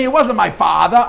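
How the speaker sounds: put-on a touch of a Brooklyn accent, loud and insistent. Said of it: "I can hear that New Brooklyn accent".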